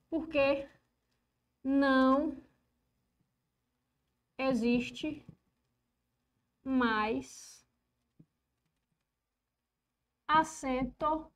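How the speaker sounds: very slow, long pause after every word; halting, drawn-out board dictation. Real, not acted. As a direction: dictate while writing, stretching each word. Dictates while writing the rule.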